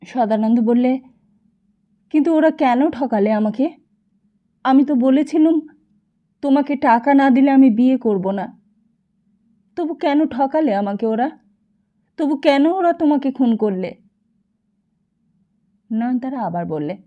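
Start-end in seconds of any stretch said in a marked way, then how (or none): 2.1-13.9 louder, higher, anguished demanding why; a wronged man crying out in grief; anguished loud protest; anguished husband. Acted. Sadananda's anguished outburst.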